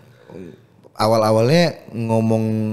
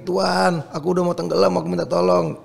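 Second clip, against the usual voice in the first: mock pleading; 7 semitones higher than usual. Drowning man pleading again.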